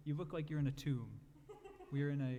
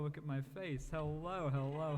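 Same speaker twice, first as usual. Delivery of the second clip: usual speaking voice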